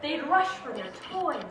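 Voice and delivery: plain reading voice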